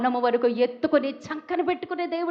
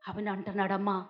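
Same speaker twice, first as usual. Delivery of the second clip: normal talking voice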